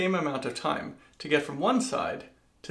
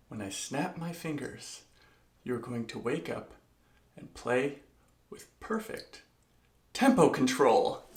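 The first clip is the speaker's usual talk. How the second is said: mesmerizing. Pretend. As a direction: gently command like a hypnotist. Hypnotic suggestion continues.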